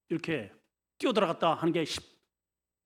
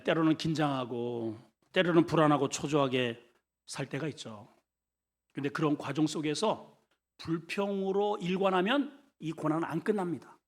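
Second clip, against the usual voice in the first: ordinary talk, nothing marked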